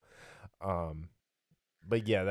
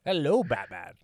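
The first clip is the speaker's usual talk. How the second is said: sneering panto villain; 6 semitones higher, 6 dB louder, slower than usual. Retold or imitated. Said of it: "he's just like".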